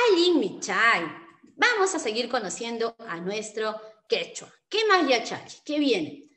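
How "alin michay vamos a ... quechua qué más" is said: usual speaking voice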